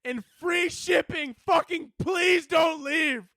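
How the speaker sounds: high, slow, pleading shout; desperate begging shout. Retold or imitated. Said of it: "then they go".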